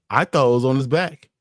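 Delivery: ordinary talk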